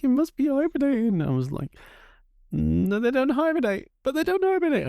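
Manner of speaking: put-on confused child. Confused child arguing with herself.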